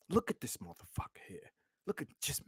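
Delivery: soft whispered aside. Real, not acted. Streamer points chat to a man on screen, whispering. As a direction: lean in and whisper it.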